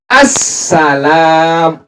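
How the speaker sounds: very slow, "Assalam" with a held double s; teacher showing the doubled sin. Shown as a demonstration. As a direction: stretch the sin in "Assalam" slowly. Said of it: "Ada tasdidnya pada huruf sin".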